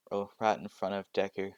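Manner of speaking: normal talking voice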